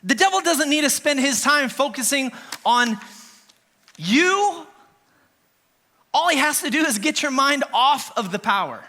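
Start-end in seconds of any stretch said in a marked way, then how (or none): 4.0-4.7 "you" lifted high and held; stress on you. Acted. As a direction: hit "you" hard after the pause. Preacher stresses single word.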